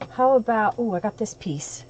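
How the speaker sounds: usual speaking voice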